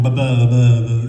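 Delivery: loud mock spluttering; dumbfounded stammer. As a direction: imitate being lost for words, repeating one syllable. Dumbstruck stammer acted out.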